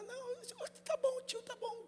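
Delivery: put-on very high, soft child's voice; little girl. Pastor imitating a child answering the uncle, high and soft. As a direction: brush the grown-up off sweetly like a small kid.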